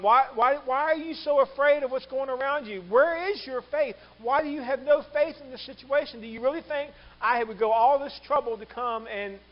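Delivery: loud, high, pressing questions; insistent rebuke. Acted. Jesus challenging disciples in the storm, insistent rebuke.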